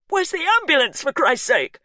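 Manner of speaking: high, loud demand; frantic shout. A narrator's reading. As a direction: shout in desperation. Hugh frantic.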